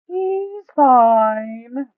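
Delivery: high, loud, slow put-on voice for the greedy Duke. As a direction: drag the words out, high and loud, savouring the loot. Duke dismisses worry.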